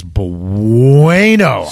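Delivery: long swooping "bueno"; milk the word for comic emphasis; drawn-out exclamation. Real, not acted. Mock drawn-out exclamation about bad defense.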